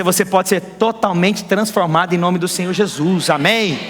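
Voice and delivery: ordinary delivery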